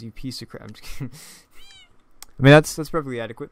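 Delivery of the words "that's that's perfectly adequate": ordinary talk, nothing marked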